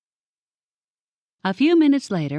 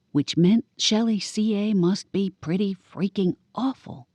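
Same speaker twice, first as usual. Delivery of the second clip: ordinary delivery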